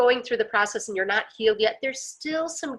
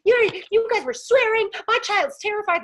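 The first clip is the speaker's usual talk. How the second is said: high, loud mock yell; imitated screaming; 9 semitones higher, 5 dB louder than usual. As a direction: imitate an irate woman yelling. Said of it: "screaming and yelling at them".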